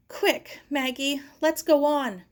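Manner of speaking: high, loud urging; urgent. Acted. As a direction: hurry her along. Sarah urging limping friend.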